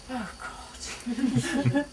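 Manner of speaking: ordinary delivery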